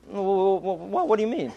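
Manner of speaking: mock the coach's panicked stammer. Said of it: "the coach goes".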